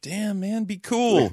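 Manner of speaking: very high, strained put-on voice; mock pleading. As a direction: imitate alarmed fans begging for restraint. Voicing embarrassed fellow fans' plea.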